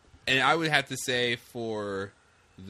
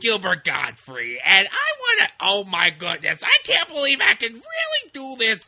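much higher and louder squawk; loud high screeching Gottfried voice; Gilbert Gottfried impression; 9 semitones higher, 9 dB louder than usual. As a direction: shriek it like Iago the parrot. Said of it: "Do it again, just for the podcast".

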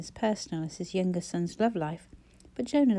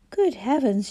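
hoarse; 4 semitones higher, 5 dB louder than usual. A narrator's reading. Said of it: "she said hoarsely".

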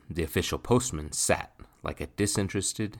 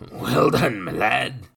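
high, loud gloating crow; loud drunken crow of triumph; 8 semitones higher, 9 dB louder, slower than usual. A narrator's reading. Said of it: "Nathan crowed".

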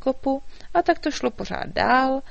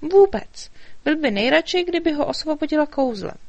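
high, eager fairy voice; little fairy, worried; 8 semitones higher, 5 dB louder than usual. A narrator's reading. Fairy explaining the king's trouble to the dragon, high and urgent.

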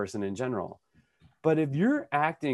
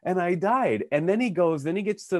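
amused; 8 semitones higher than usual. Real, not acted.

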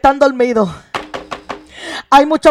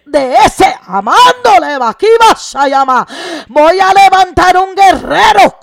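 fervent preaching; 2 semitones higher, 5 dB louder, slower than usual. Real, not acted. Preacher promises the congregation a warrior will rise, impassioned. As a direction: hammer each phrase home like a revival preacher.

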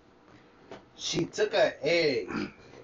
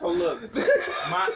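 laughing; 13 semitones higher, much slower than usual. Real, not acted. Laughing then starting story.

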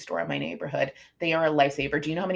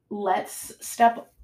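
ordinary talk, nothing marked

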